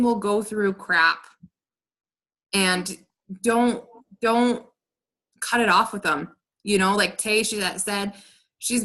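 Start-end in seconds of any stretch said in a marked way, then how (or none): none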